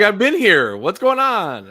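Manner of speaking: high, loud mock bewilderment; acted-out protest. Player retelling confusion to the group, acted-out protest. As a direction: pitch it way up, playfully indignant. Acting out own confused reaction.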